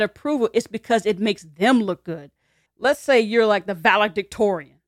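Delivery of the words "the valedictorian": usual speaking voice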